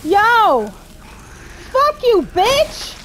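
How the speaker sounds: high, loud yell at an attacker; alarmed, angry shout. Real, not acted. Player cursing the monster after a scare, angry shout. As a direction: yell back in startled fury.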